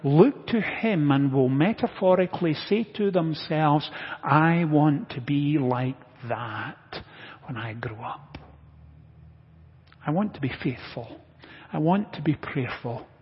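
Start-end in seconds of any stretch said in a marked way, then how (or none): 4.2-13.1 low, softened, unhurried wish; quiet inner resolve. Acted. Churchgoers silently admiring an older man, quiet inner resolve. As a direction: voice a hushed private thought, dropping away at phrase ends.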